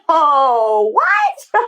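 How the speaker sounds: high laughing "what?"; stunned disbelief. Retold or imitated. Mother realizing her son was fine, high laughing disbelief. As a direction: squeal in disbelief. Incredulous reaction retold.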